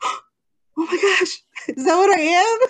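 tearful, delighted amazement; astonished gasp. Retold or imitated. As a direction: relive the shock of being called a designer.

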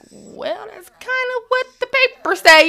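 very high, hedging voice; tentative correction. Retold or imitated. Wife retelling her reply to husband in denial, tentative. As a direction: pitch it way up, gingerly pointing out the obvious.

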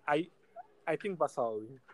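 usual speaking voice

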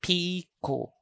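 Piko as a long, smooth PII-ko; piko without stops. Shown as a demonstration. Teacher repeats the word again, Piko long and open. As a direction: stretch the first vowel of "Piko", let it fall. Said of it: "So the word again is piko".

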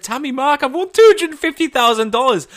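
high, loud, thrilled announcement; excited shout. Retold or imitated. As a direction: shout the big win at them. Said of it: "And I was so excited".

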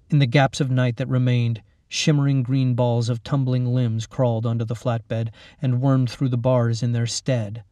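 usual speaking voice

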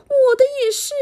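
high, loud mother bear; Mommy Bear voice. Acted. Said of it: "妈妈熊说".

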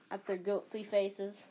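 ordinary delivery, nothing marked

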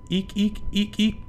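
high squeaks; squeaky eek. Acted. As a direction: squeak like a shoe. Squeaky shoe.

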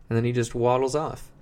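usual speaking voice

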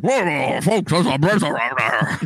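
hammed-up bearded storm-warner, words smeared together; big bearded guy. Bearded guy warning folks of a blizzard, hammed-up slurred voice. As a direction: call it out like a bearded old-timer. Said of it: "a big beard being like".

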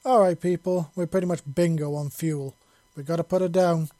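pilot calling back loud to the cabin. Acted. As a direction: raise the voice to carry over the cabin.

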